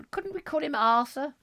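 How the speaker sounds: high prim wife voice. Acted. Wife answering husband about baby, high prim voice. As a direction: pitch it up as the fussing wife.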